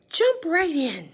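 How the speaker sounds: high, loud, slow exclaim; exclamation. Shown as a demonstration. Teacher rereading with the exclamation point, high and loud.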